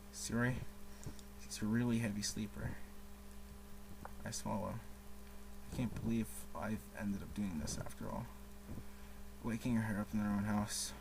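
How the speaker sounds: reading game text. Acted. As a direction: murmur the narration to yourself. Reads the game's narration aloud.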